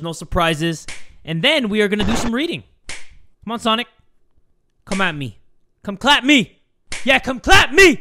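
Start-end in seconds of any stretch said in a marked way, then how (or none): 5.8-8.0 loud, high taunting yell; taunting shout. Real, not acted. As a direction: dare him to come, loud and cocky. Taunting Sonic to come at him.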